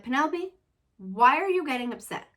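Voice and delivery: mom voice to daughter. Retold or imitated. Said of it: "And I'm like".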